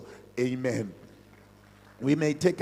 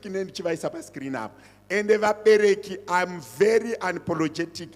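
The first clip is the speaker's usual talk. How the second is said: loud preaching, rising and insistent; preaching at full force; 4 semitones higher, 4 dB louder than usual. Real, not acted. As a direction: drive the warning home to the parents. Pastor warns parents from pulpit.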